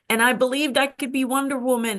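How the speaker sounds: ordinary talk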